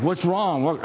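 high, mock-alarmed question; mildly panicked check on someone; retold freak-out. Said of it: "I'm, like, freaking out".

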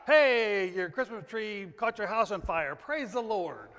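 mock cheerful. Preacher mocking praise for a house fire, hammed-up cheer. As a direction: ham up the delighted believer.

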